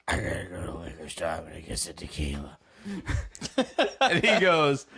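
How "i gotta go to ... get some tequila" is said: low croaky self. Retold or imitated. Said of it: "And I'm like".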